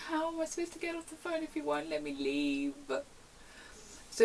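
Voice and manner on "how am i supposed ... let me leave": high mock wail; hammed-up whiny desperation; exasperated plea. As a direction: plead helplessly with a caller who won't listen. Exasperated pleading with angry caller.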